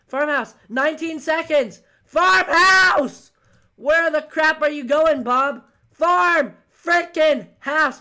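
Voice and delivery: loud, high frantic orders; exasperated high-pitched yelling; urgent shouting. Real, not acted. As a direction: yell at a teammate against the clock. Urging teammate as time runs out.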